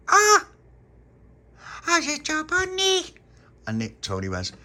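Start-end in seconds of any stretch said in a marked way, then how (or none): 0.1-0.5 put-on very high, loud baby shriek; baby's shriek. Baby finds no jam on cheek. 1.8-3.1 put-on very high, loud baby gabble; squealing baby. Baby's garbled complaint to Tony.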